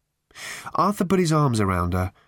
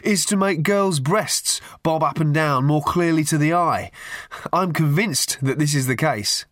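higher-pitched, earnest pontificating; 6 semitones higher than usual. A narrator's reading. Youth airing his theory in the park, earnest. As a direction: put the theory with great conviction. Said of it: "said the voice earnestly".

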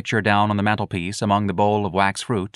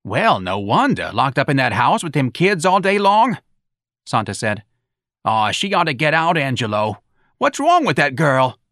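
louder, higher New Orleans aunt; gossiping aunt; 5 semitones higher, 4 dB louder than usual. Acted. Santa fussing to Angelo about his wife. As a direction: cluck over the girl's troubles.

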